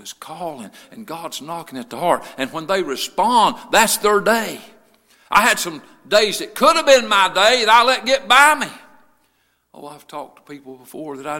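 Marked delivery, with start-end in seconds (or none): 2.4-8.7 loud, rising sermon delivery; impassioned preaching. Real, not acted. Preacher on the days he let get by, impassioned and loud. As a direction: build into a high preaching cry. Preaching rises to full force.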